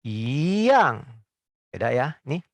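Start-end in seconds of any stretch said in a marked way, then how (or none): 0.0-1.0 "yang" with a rising i; "i yang" with second-tone yi. Shown as a demonstration. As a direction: lift "i" upward before "yang".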